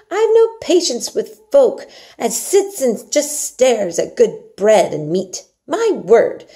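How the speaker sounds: loud, slow, indignant Yorkshire maid; indignant Martha. Acted. Martha vents indignation about wasted food. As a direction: scold the picky eater. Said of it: "Martha looked indignant".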